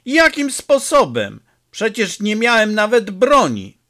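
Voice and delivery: high, loud indignant roar. A narrator's reading. Peppone roaring his innocence at his comrade. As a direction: bellow the denial. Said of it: "ryknął Peppone".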